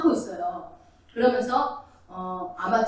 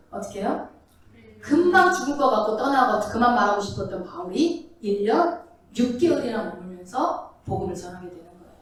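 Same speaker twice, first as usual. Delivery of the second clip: ordinary talk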